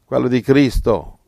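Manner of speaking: fiery preaching. Acted. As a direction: preach it out with fire. Preaching at full force. (predica infuocata, più forte e più acuta)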